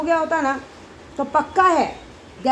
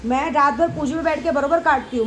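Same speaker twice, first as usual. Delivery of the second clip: ordinary talk, nothing marked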